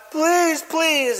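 high-pitched theatrical plea; mock begging. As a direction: beg the crowd on your knees. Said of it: "he slumps to his knees".

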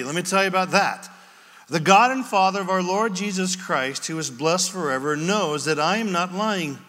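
ordinary delivery

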